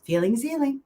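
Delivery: high sung-out mantra. Retold or imitated. Reciting her mantra to herself, lifted high. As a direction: lift it up like a chant. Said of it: "That's my mantra".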